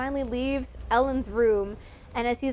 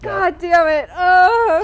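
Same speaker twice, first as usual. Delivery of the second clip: slow, much louder and higher anguished curse; drawn-out, loud, pained cry of frustration; exasperated outburst; 7 semitones higher, 11 dB louder, much slower than usual. Retold or imitated. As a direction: blurt it out like the ending just gutted you. Anguished reaction to movie ending.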